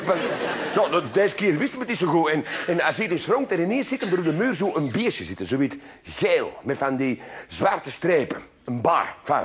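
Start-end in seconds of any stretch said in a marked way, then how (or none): none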